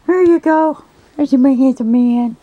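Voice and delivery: high-pitched doting pet talk; doting pet praise. Real, not acted.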